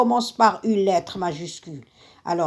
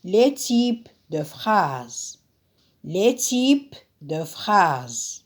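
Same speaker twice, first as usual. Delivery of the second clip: slow dictation; slower than usual. Shown as a demonstration. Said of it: "Le titre".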